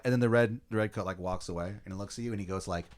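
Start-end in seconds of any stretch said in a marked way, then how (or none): none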